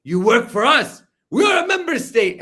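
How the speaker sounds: imitating an arrogant diplomat shouting; mock shout. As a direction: lord it over her, shouting. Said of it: "look at how he treats".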